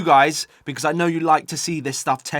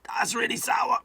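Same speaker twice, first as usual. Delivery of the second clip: strained whisper; 7 semitones higher, 5 dB quieter than usual. Real, not acted. Just tasted pure citric acid.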